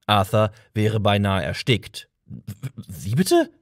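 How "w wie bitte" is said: stammering. A narrator's reading. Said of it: "stotterte er".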